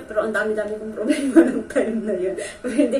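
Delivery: half laughing, half tearful; laughing through it. Real, not acted. Recalling her many problems to viewers, tearful laugh.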